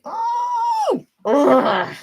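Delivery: high, loud huff of disgust; mock a sulky angry groan; mad groan. Mimicking an angry Cancerian, high disgusted huff. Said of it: "when they're mad".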